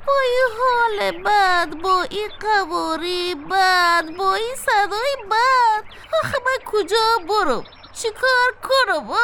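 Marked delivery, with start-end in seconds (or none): none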